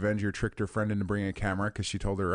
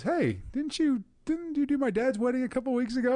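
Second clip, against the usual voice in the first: quoting a friend. Retold or imitated. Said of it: "Matt looks and looks at us and says".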